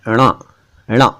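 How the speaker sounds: ण as a nasal retroflex flap; lightly nasal ण. Shown as a demonstration. Teacher showing the third sound, slightly nasal ण. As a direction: let ण go slightly through the nose. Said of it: "हल्का सा nasal".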